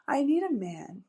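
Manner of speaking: lightly sultry plea; sexy. Shown as a demonstration. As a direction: say it as a playful tease to a husband. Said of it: "Do you hear how sexy it is".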